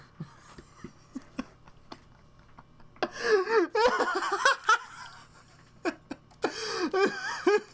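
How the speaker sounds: can't stop laughing; crying with laughter; helpless laughter. Real, not acted. Laughing fit.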